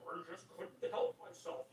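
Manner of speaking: mock proud announcement. Imagining a man announcing his embarrassing condition, mock proud. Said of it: "like, proudly announcing it".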